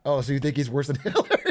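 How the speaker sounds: quick question breaking into laughter; laughing mock accusation; joking. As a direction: ask it as a joke and crack up. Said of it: "you're joking and they're not".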